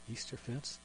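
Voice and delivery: hushed, tentative question; low voice. Retold or imitated. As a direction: imitate a lady asking in a low voice. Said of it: "kind of low voice".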